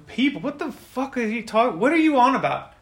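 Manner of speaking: high, loud scornful outburst; acted indignant bully; angry disbelief. Bigger person scoffs in argument.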